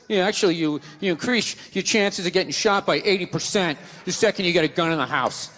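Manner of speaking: low, lightly voiced stats reciter; a hint of a droning pedant; mock know-it-all. Gun opponent throwing out stats, mock know-it-all.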